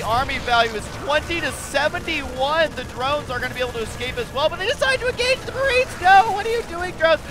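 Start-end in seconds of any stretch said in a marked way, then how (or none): 4.5-7.2 high, loud cry of disbelief; alarmed commentary. Real, not acted. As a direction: shout in dismay at the blunder. Dismayed live commentary on a blunder.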